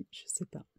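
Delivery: chuchoté, un aparté hésitant; whispered aside. Real, not acted. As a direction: murmure pour soi, en doutant. Aparté hésitant sur la prononciation. (chuchotement hésitant pour soi-même)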